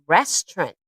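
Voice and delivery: Restaurant said as two syllables, res-trant, middle syllable dropped; native speaker pronunciation. Shown as a demonstration. Teacher modelling Restaurant for the class, middle syllable dropped. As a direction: say Restaurant as ress-trunt, swallow the middle. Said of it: "You almost don't hear this middle syllable".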